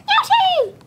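high squeaky Yoshi cry; Yoshi call. Acted. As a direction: squeal the name like a little dinosaur. Voicing Yoshi character.

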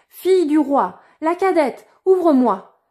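loud, high call at the door. A narrator's reading. Frog calling the princess a second time, loud call. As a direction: knock and call her by name. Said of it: "on frappa pour la seconde fois à la porte et la voix appela".

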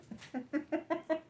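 quick high-pitched giggle; nervous giggle. Real, not acted. Recipient about to open a gift, giggling nervously. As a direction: let out a high, fluttery laugh.

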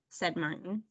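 ordinary delivery, nothing marked

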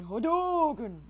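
loud, drawn-out attack call; mock battle cry. Player attempting another rocket jump, long playful battle cry. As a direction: stretch the move name out, high and loud.

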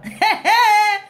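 a loud laugh, half crying. Real, not acted. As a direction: burst out laughing. Bursts out laughing at joke.

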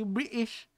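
"British" with the T left out; British-style glottal stop in "British"; dropped T. Shown as a demonstration. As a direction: say "British" with a catch in place of the T. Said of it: "yung parang walang T".

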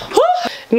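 high breathless whew after climbing; exhausted whew. Real, not acted. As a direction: blow out a winded whew. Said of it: "I'm so out of breath".

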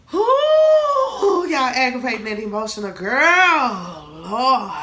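very high, loud crying whoop; mock emotional wail. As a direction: wail it like a dramatic Cancer girl. Mock wail over Cancer woman.

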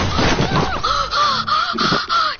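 a run of high, loud gasps; loud, high startled gasping; scared gasps. Real, not acted. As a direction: gasp hard in fright. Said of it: "it scared me so bad".

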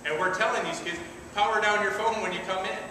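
usual speaking voice

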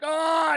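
high, loud mock monster roar; spider roar. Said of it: "the spider roars".

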